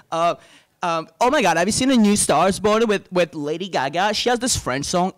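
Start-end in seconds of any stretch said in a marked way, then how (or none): 1.2-5.1 mock starstruck gushing; very excited. Woman asking a Frenchman about a French song, mock very excited. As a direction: imitate an excited fan, a little higher. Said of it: "very excited about Paris".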